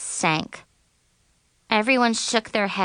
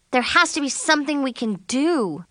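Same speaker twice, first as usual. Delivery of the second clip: higher-pitched plaintive moan; 5 semitones higher than usual. A narrator's reading. Friend wishing to fix the disqualification, a plaintive moan. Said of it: "Zoe moaned".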